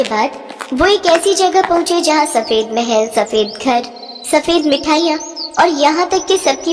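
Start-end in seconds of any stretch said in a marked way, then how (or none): none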